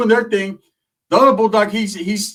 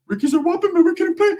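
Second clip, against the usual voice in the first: high mock voice; 13 semitones higher, faster than usual.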